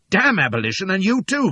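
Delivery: loud, high furious cry. Acted. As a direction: spit the curse at him. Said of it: "cries I".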